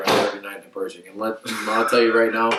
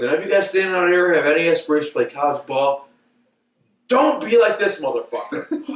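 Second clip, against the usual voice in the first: raised, high-pitched public dressing-down; retold coach's tirade; 6 semitones higher than usual. Coach warning recruits off a player, retold angry rant. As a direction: imitate a coach berating a player for all to hear. Coach berating him before team.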